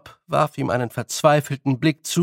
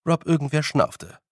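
ordinary delivery

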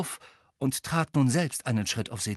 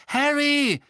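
high, loud alarmed cry; very frightened; 8 dB louder than usual. A narrator's reading. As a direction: call his name out in alarm, high and drawn. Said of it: "wirkte sehr verängstigt". (sehr verängstigt)

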